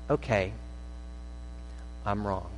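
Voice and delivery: the one who finally gives in, quiet; quiet concession. Pretend. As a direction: let the fight go and admit it softly. Conceding the argument.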